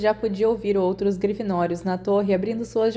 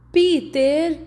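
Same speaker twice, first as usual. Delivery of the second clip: much higher, louder call of Peter; scolding shout; 8 semitones higher, 5 dB louder than usual. A narrator's reading. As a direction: yell at him for the mistake. Scolding after the accident. (grito repreendendo Peter, agudo e alto)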